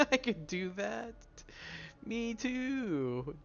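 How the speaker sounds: squeaky mock voice; silly high voice. Repeats it in a silly voice.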